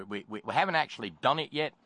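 usual speaking voice

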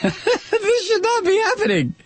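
very high, squealing with laughter; incredulous laughter. Real, not acted. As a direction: repeat it helpless with laughter. Laughing at newspaper's understatement.

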